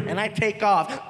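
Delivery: ordinary talk, nothing marked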